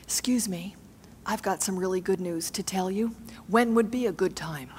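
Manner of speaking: coach's suggested polite whisper at the partner's door. Shown as a demonstration. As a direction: tiptoe in and ask ever so politely. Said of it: "in your best F M D J voice".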